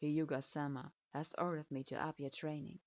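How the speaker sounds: hard, clipped authority of a teacher; lightly stern sensei. Acted. Sensei speaks.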